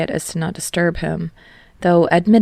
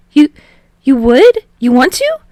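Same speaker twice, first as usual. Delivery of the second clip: much higher, louder stunned hope; hopeful surprise; 9 semitones higher, 10 dB louder than usual. A narrator's reading. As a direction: Hinata can't believe his luck. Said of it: "he asked hopefully".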